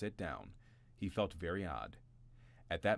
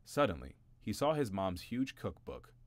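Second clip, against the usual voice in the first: ordinary delivery, nothing marked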